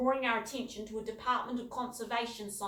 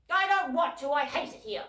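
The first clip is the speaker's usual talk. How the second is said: sulky refusal; 6 semitones higher, 9 dB louder than usual. A narrator's reading. Child refusing, arms crossed.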